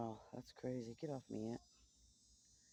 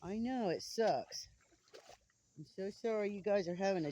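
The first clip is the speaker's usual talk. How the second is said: talking to a pet; 6 semitones higher, 7 dB louder than usual. Real, not acted. Talking to a fussing chicken.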